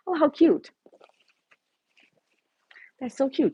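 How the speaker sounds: high, loud gushing; excited cute. Real, not acted. Streamer gushing at a viewer's pigtails, high and loud. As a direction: gush over it.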